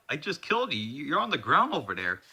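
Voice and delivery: high-pitched, swooping disbelief; incredulous protest. Acted. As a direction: squeal in bafflement at the impossible. Character baffled by revived boss.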